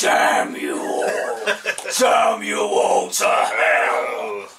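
slow, loud laughing villain curse; hammy curse. Acted. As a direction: drag out a melodramatic curse.

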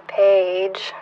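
ordinary delivery

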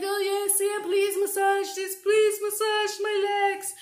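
milk it like a pampered mother begging; whiny mother. Retold or imitated. Mother in pain at night asking her daughter for a massage, whining high and slow. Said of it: "she'd be like".

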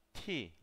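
"T" said as aspirated "tee"; breath-released English "T"; English-style T. Shown as a demonstration. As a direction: demonstrate "T" with air pushed out after it. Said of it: "T, así".

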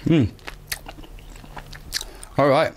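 muffled through chewing; chewing while talking. Real, not acted. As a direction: mouth partly full. Seen 1: Put candy in mouth at -5; jaw and cheeks working throughout.

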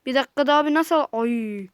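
usual speaking voice

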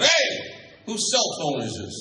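mock call across the room; yelling out. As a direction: holler a question to everyone, joke-telling style. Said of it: "turns around and yells out".

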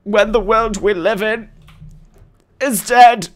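high, theatrical grieving; mock lament. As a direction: lament it high, rising in volume. Mock lament for dead villages.

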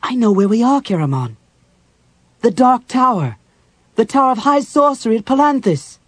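high, loud burst of recognition; alarmed realization. A narrator's reading. Cleric realizes they are in the Dark Tower, alarmed. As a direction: cry it out as the truth dawns. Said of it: "Chrysania caught her breath".

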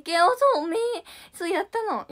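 high and loud, eager; jumping in eagerly; excited burst. Real, not acted. Idol eagerly answers a fishing comment, high and loud.

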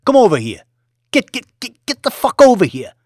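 loud high screamed commands; furious orders. Acted. Boss ordering violence.

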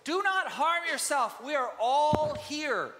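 loud, high and slow, calling out; loud cry. A narrator's reading. Paul shouting to the jailer not to harm himself. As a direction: call across the prison to stop him. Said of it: "cried out in a loud voice".

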